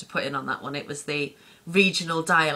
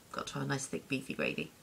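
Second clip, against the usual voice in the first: softer, quicker repeat under the breath; quiet aside; 3 semitones lower, 10 dB quieter, faster than usual. Real, not acted. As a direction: toss it off softly and fast, like a private vow. Repeating the gravy wish.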